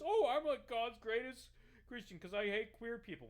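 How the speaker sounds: high, loud hammed-up proclamation; mock boasting. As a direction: crow it sarcastically as an imitation. Mocks candidate boasting of his faith.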